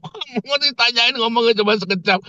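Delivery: high-pitched exasperated complaint; retold outburst. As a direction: re-enact losing your temper, voice shooting up. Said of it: "Saya ngamuk". (menirukan omelan kesal bernada tinggi)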